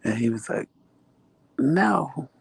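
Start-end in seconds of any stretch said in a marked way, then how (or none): 1.6-2.3 high, drawn-out no; mock whiny refusal. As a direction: lightly imitate a husband's high-pitched refusal. Imitates drunk husband refusing.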